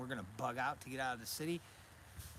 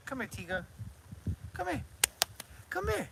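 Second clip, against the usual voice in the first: high, loud coaxing call; calling a dog; 12 semitones higher, 4 dB louder than usual. Real, not acted. Owner coaxing the dog over to say hi, high calling voice.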